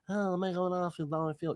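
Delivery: quiet, mumbled Godfather voice; Godfather impression. Staring in the mirror, doing his Godfather voice. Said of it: "unless I'm doing Godfather".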